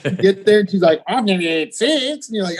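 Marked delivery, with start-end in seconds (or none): 1.1-2.3 higher mock Australian accent. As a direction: imitate an Australian woman, pitched up. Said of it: "In Australian?".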